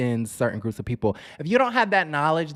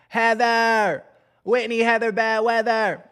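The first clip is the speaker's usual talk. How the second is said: high, loud call of names; playful calling out; 8 semitones higher, 4 dB louder, slower than usual. Pretend. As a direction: sing out the names loudly, drawn high. Calls out names in playful outburst.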